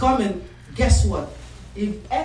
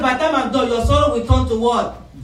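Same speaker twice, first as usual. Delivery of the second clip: loud, higher call for an answer; emphatic repeat; 4 semitones higher, 6 dB louder than usual. Real, not acted. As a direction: drive the promise home and prompt the congregation. Louder repeat prompting congregation's answer.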